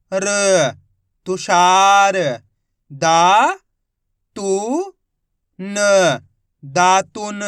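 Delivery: each syllable called out slowly and loudly, then the whole word drawn out; teacher spelling each syllable aloud; syllable-by-syllable reading. Shown as a demonstration. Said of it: "मैं किस तरीके से एक एक अक्षर का उच्चारण करके इनको पढ़ रहा हूँ". (अक्षर-अक्षर ज़ोर से बोलकर पढ़ाना)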